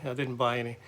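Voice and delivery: quiet rueful echo; soft aside. Real, not acted. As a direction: mutter it again to yourself. Repeated softly as aside.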